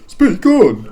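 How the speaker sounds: voice try. Acted. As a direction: belt it as a new character. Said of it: "cycle through every voice".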